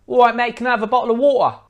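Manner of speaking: put-on high, loud, quick London accent with glottal stops. Customer asking mate for water, loud London accent. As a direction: rattle it off as a Londoner, dropping T's.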